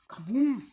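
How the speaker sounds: kaboom sound effect. Real, not acted. Sound effect of coin trap.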